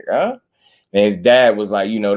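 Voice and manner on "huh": high-pitched questioning huh, re-enacted; puzzled huh. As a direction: replay your baffled reaction, pitched way up. Acting out own puzzled reaction to interview.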